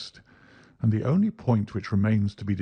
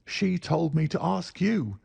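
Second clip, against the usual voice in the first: pleading; 8 semitones higher than usual. Acted. Little man pleads, slow.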